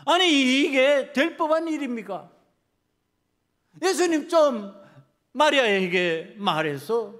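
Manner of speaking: loud, high, exasperated demand; angry protest. Acted. Martha demands that Jesus speak to Mary, exasperated and angry. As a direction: burst out loudly in indignation. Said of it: "그 화나는 것을".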